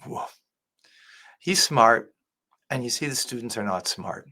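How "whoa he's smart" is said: usual speaking voice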